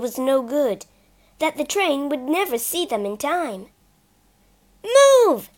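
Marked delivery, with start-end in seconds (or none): 4.8-5.5 very loud, much higher shout. Acted. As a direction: yell urgently. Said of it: "shouted Peter".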